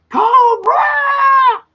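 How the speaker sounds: high-pitched drawn-out battle cry; mock yell. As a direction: yell it very high, stretching the last vowel. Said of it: "look at me and go yell".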